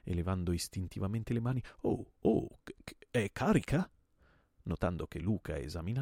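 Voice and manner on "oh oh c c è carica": stammering, high alarmed question; pale with fright. Acted. Host faced with a drawn revolver, frightened stammer. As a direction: gasp it out and stumble into the question. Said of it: "diventato pallidissimo".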